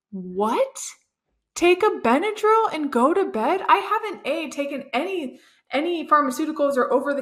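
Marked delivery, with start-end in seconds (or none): 0.1-3.6 higher, disbelieving "Take a Benadryl and go to bed?"; incredulous echo. Retold or imitated. Retelling her reaction to the midwife's advice, incredulous. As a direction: repeat the advice back like it's absurd.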